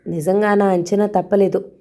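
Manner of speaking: ordinary delivery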